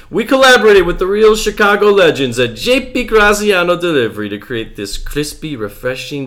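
put-on high, loud Chicago guy faking an Italian accent. Host reads the brewery blurb in a Chicago-Italian accent. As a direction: read it like a Chicago local doing Italian. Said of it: "a Chicago accent trying to do an Italian accent".

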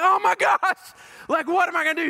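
hammed-up frantic worry; mock panic. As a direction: play the panicked guy, voice jumping up. Panicking over the neglected dogs.

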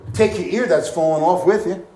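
normal talking voice

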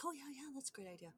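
high, fast breezy yes-woman; mock eager publicist. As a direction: toss it off airily. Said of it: "this gal was like".

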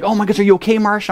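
high, urgent retold worry; alarmed concern. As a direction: act out checking on a hurt wife. Retelling his alarmed check on wife.

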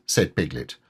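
usual speaking voice

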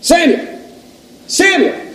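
high, loud, falling name calls; calling out. Acted. The Lord stands there calling Samuel, calling out. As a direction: summon someone by name, twice, pitched high. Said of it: "calling at other times".

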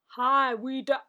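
loud, bad Scottish accent; badly faked Scottish greeting; attempted Scottish accent. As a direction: have a clumsy go at Scottish. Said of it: "I wanna do this Scottish".

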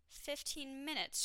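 high but slow, drawn-out announcement. A narrator's reading. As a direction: stretch it out with dampened excitement. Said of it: "a little less excited than the last time".

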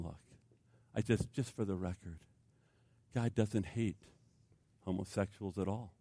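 quiet, sincere plea; tender assurance. Retold or imitated. Tender gospel assurance to heckler.

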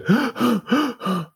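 rapid, high gasping breaths, acted out; hyperventilating gasps. Reenacting his breakdown in the counselor's office, hyperventilating. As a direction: imitate hyperventilating in short panicked gasps. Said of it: "to hyperventilate".

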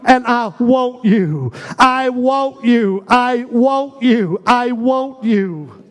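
slow drawn-out declarations. Shown as a demonstration. Leader declaring love for Jesus, slow and full. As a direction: stretch each word and lean in. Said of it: "Somebody say it louder than anybody else".

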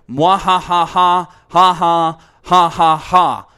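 evil villain cackle; mad scientist laugh. Acted. Villain laugh in mock quote.

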